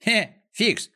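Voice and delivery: high-pitched call across the field. A narrator's reading. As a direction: shout a question to someone passing. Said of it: "закричал". (окликает криком, высоко)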